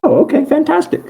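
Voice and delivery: high falsetto nurse; Nurse Joy. Acted. Game master as nurse hearing who sent it, high put-on voice. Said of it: "uh, she goes".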